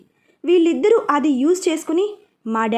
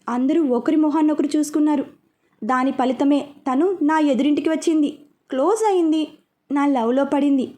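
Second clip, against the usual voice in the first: plain reading voice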